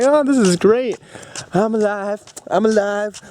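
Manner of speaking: high, elated relief; retold euphoric exclaiming; overjoyed. Retelling joy at being alive.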